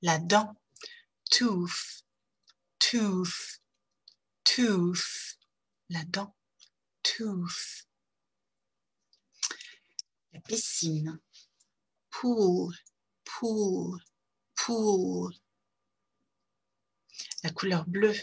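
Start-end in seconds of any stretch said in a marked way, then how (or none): 1.3-7.9 Tooth with a long rounded oo, last one whispered; English long oo. Shown as a demonstration. Teacher drilling Tooth for French learners with a long oo. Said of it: "On y va". 12.1-15.3 Pool with a long tense oo; English long oo. Shown as a demonstration. Teacher drilling Pool for French learners with a long oo. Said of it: "On y va".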